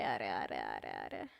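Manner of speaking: very low, growly "arre arre"; goofy low monster-ish groan of disbelief; mock deep voice. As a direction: drop the voice way down for a joke. Streamer sighs deep-voiced reaction.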